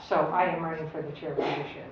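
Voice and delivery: normal talking voice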